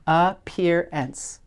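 Appearance said very slowly, each sound drawn out; slow stretched word. Shown as a demonstration. Teacher modelling the word slowly for spelling. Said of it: "Have you got it?".

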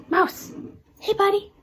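hoge, luide hondenroep; calling the dog. Real, not acted. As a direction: roep je hond enthousiast hoog. Calling her dog on camera.

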